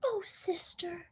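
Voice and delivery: soft, breathy plea; worried whisper. A narrator's reading. Baby pleads with her sister in a worried whisper. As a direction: whisper the warning anxiously.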